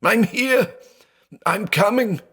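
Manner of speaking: very high, frantic reassurance; shrieking. Acted. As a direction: shriek that you're coming. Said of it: "he shrieked".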